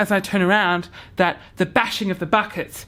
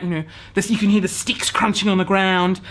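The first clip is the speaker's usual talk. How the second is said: normal talking voice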